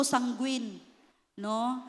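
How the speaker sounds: ordinary delivery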